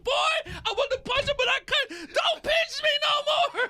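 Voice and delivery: high, laughing whine; mock kid whine. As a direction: play the wronged kid.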